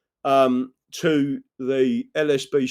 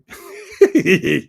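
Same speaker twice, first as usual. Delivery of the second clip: loud laugh; 3 semitones higher, 6 dB louder than usual. Real, not acted. Laugh after greeting Amy.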